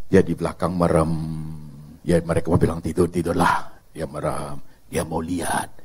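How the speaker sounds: ordinary talk, nothing marked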